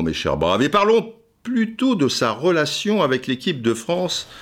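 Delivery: normal talking voice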